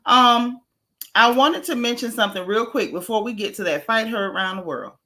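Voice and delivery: usual speaking voice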